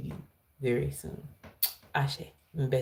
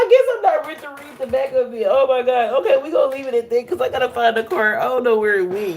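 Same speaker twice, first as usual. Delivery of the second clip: high, loud and fast; excited rush; 8 semitones higher, 5 dB louder, faster than usual. Real, not acted. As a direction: hurry it out still buzzing. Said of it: "I got too excited".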